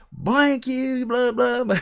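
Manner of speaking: hammed-up road rage rant; imitating an angry driver. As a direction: parody the other driver swearing out the window. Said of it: "and he's screaming at me".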